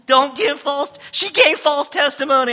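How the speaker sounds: high, loud mock child voice; mock childlike. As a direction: squeak it out like a fibbing toddler. Preacher mocking a child's lie.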